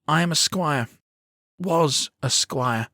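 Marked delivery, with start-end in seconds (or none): 1.6-2.9 slow, falling, the word Was weighted; correcting himself sadly. A narrator's reading. Boy correcting himself as his face falls, sad and slow. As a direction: let the pride drain on Was. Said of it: "he corrects himself, his face falling".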